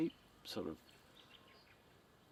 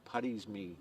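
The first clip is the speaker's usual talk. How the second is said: normal talking voice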